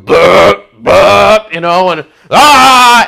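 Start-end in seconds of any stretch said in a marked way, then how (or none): 0.0-1.5 loud imitated belches; mock burp. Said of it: "everybody's going". 2.3-3.0 loud, high drawn-out "ah"; mock drunken yell. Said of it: "and they're drunk".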